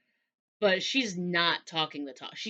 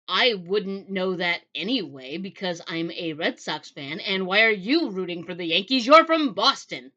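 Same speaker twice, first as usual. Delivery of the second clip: retold indignant comeback, building louder; exasperated retort; 5 dB louder than usual. Time traveler challenging the suspicious man, exasperated. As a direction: push back, voice jumping up at the end.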